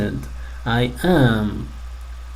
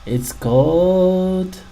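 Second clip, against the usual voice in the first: slow reveal; 6 semitones higher, much slower than usual. Shown as a demonstration. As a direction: stretch out "It's called" slowly before the answer. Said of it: "slow reveal to children".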